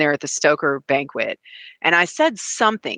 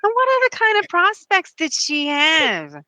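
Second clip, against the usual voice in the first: a light put-on Minnie, the last line much higher; 4 semitones higher than usual. Voicing Minnie scorning the ungrateful rescued girl, lightly put-on. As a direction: slip into Minnie's smug tone. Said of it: "I'm kind of disturbed by how well you do, Minnie".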